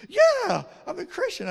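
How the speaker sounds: loud, high-pitched indignant comeback; indignant retort. Retold or imitated. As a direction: fire back as if the answer is obvious. Indignant student in retold exchange.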